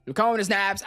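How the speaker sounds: ordinary delivery, nothing marked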